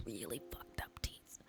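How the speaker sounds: whispered, as if too embarrassing to say aloud; hushed confession. Real, not acted. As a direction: drop into a breathy whisper. Embarrassing confession about her old teeth.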